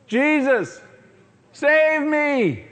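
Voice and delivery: high, loud cry for help; desperate cry. Acted. Peter sinking calls to Jesus, a high loud cry. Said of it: "he cries out and says".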